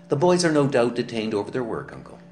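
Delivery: put-on quickly calm the old man; smoothing it over. A narrator's reading. Naomi soothing her uncle about the absent boys. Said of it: "applied the antidote".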